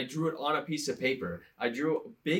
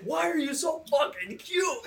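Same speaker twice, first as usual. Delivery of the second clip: high, strained mock shout; screaming outburst; 13 semitones higher, 3 dB louder than usual. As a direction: scream it as the exasperated friend, half laughing. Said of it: "he's screaming to me".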